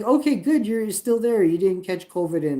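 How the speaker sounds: high, loud sarcastic retelling; sarcastic mock relief. Retold sarcastic reply to support.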